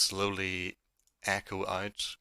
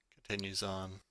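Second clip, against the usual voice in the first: ordinary talk, nothing marked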